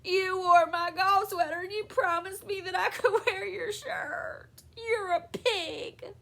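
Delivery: high, hammed-up tearful wail; bawling sobs. A narrator's reading. Wife sobbing to husband about broken promise, bawling. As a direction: wail it out through childish sobs. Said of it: "began to bawl. She sobbed".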